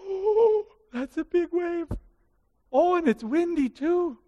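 growing alarm. Acted. Peter notices wave, grows afraid.

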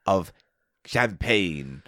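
a hint of a 1940s American toff savouring champagne; old-school American posh. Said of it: "your old school sort of American posh".

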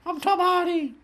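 Tom Hardy voice. Pretend. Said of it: "That was the voice".

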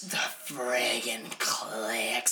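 slow, drawn-out whisper; hammed-up whispered craving, every word stretched; mock lustful whisper. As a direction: whisper it with exaggerated relish. Echoes imagined editor, lustful whisper.

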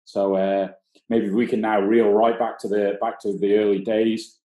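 normal talking voice

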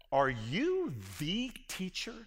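slow question leaning on "the"; emphatic THE. Acted. Jesus questions Nicodemus, teacher of Israel, emphatic on "the". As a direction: draw out "the" as "thee" before "teacher". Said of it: "it's emphatic. It's a definite article".

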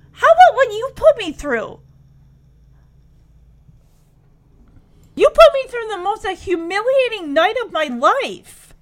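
imitating a wounded, heated comeback; indignant retort. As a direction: throw the accusation back, loud and hurt. Acts out Kimmy's angry retort.

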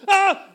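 high, loud burst of "ah"; retold scream. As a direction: yelp while tearing downhill. Retold scream while running downhill.

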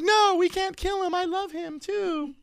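very high, slow mock woman's voice; mock falsetto sister. Mocking the sister character in falsetto. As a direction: swoon it like a lovesick soprano. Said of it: "the prostitute sister is like".

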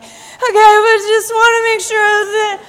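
high, loud, half-laughing worry; anxious pleading. Retold or imitated. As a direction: push for reassurance with a nervous laugh. Anxious self pleading with God.

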